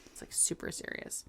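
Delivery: hushed mock-serious whisper. Real, not acted. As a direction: whisper it for effect.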